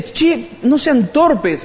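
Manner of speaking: high, slow, exaggerated scolding of a friend; exasperated Pablo. Pablo scolds the foolish Galatians, high and slow. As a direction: drag it out like a fed-up older brother. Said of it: "Les dice".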